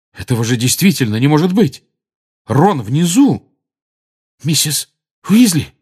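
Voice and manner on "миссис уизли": high, hoarse, frightened call of her name; hoarse question. A narrator's reading. Harry finding Mrs Weasley sobbing, a hoarse scared call. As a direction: croak her name out in shock. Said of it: "хрипло спросил Гарри".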